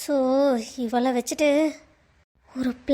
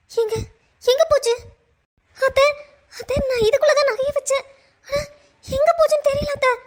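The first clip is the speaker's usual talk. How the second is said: higher, voice breaking into tears; pleading and crying over the loss; tearful panic; 8 semitones higher than usual. Acted.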